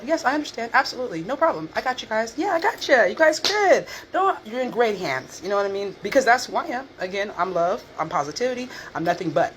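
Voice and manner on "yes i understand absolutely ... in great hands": much higher, bright customer-service politeness; fake-sweet professional voice. Answering rude coworkers at work, high sugary professional voice. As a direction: smile through it, overly accommodating. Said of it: "Always professional".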